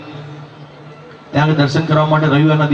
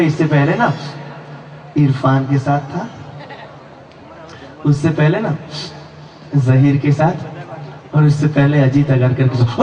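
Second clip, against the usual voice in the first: fiancée's voice. Acted.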